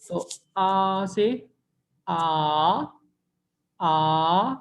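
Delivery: आ sliding upward, slowly; rising second tone. Shown as a demonstration. Teacher models a rising second-tone आ. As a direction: let आ climb like a question. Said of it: "उसकी shape जो है वो ऊपर की तरफ है".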